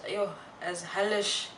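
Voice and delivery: ordinary delivery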